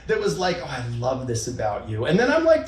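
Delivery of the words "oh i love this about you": low, softened loving compliment; tender praise. Retold or imitated. Partner praising what she loves about him, tenderly. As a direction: imitate a partner gently showing appreciation. Imitates the loving, positive approach.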